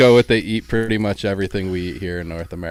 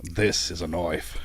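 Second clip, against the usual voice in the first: Crocodile Dundee line; 3 semitones lower, 7 dB quieter, slower than usual. Pretend. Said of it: "Let me just hear you say".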